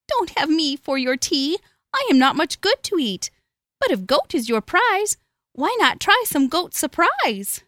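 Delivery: high, sweet little goat voice; little goat pleading. A narrator's reading.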